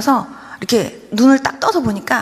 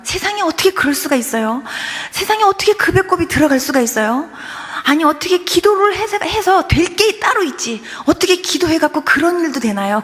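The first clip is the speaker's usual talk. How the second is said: higher-pitched, incredulous retold questions; 믿기지 않는 아빠; 5 semitones higher than usual. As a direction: act out an amazed father protesting it can't be. Amazed father, acted. (높은 톤으로 믿기지 않는다는 듯 따져 묻기)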